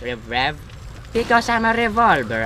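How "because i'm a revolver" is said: high-pitched revolver character; Rev. Pretend. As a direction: explain it as the talking gun, pitched up. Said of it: "I should probably talk slowly".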